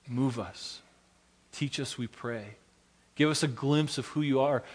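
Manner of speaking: hushed pastoral prayer. Acted.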